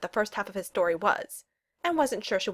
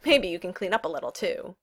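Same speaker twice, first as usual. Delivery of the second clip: ordinary delivery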